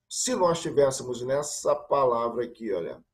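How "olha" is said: ordinary talk, nothing marked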